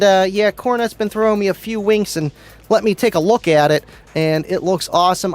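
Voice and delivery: normal talking voice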